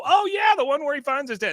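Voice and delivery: very high, loud mimicked outburst; high-pitched, gushing mock recognition; excited recognition. Acting out clients' delighted recognition.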